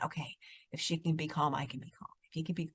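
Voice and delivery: soft, low murmured self-talk; hushed imitation of someone calming down; calm inner thought. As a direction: think it aloud very quietly.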